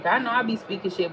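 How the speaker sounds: usual speaking voice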